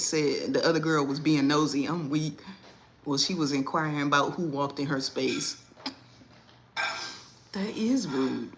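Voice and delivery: ordinary delivery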